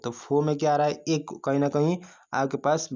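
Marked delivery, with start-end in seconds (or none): none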